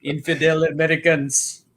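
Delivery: a hammed-up villain's Middle Eastern accent; mock Middle Eastern accent. Shown as a demonstration. Doing his Tusken Raider voice for his co-host. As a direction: snarl it like a cartoon movie terrorist. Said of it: "I've got written down Tusken Raider".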